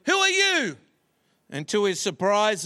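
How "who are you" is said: high-pitched mock-angry holler; acted yell, pitched way up. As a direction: call it out like an indignant boy. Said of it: "Angry, the boy yelled".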